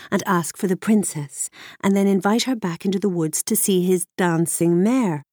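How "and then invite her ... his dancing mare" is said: usual speaking voice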